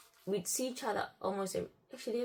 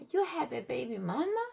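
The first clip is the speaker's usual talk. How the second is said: louder echo question, pitch climbing; high, disbelieving query; retold incredulity; 6 semitones higher, 5 dB louder than usual. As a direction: act out a stunned repeat. Retold shock at the revelation.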